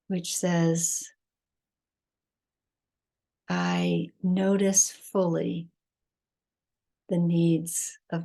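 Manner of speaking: measured meditation voice with long pauses; guided meditation. Acted. Teacher leading listeners to breath and heart, guided meditation. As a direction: guide them unhurriedly, phrase by phrase, leaving space between.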